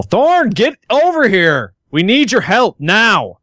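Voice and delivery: high urgent call across the room; yelling. Acted. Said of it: "approach the doorway and yell".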